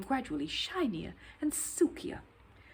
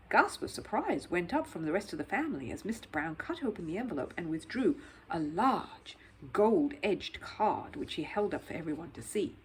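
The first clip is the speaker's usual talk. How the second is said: plain reading voice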